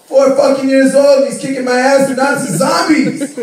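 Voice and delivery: high, loud comic indignation; outraged rant. Acted. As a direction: shout it in disbelief at losing. Comic outrage at losing to son.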